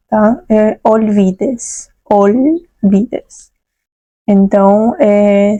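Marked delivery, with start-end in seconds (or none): 0.5-3.5 olvides slow with a firm L; strong clear L. Shown as a demonstration. Teacher to students, slow olvides with a firm L. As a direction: draw out olvides, sounding the L. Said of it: "se pronuncia bem forte".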